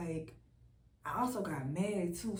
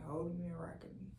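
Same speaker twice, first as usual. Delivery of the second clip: soft unvoiced whisper, a bit quick; 3 semitones lower, 5 dB quieter, faster than usual. Real, not acted. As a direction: hush it down to a breath. Repeats line as a hush.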